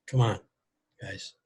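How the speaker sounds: usual speaking voice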